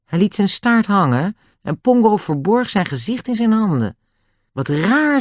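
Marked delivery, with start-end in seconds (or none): 4.5-5.2 mildly astonished, high pitch; a touch of bewilderment, voice lifted; Dick, puzzled. A narrator's reading. Boy puzzled by the animals' behaviour, mild high surprise. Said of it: "verbaasd over het vreemde gedrag".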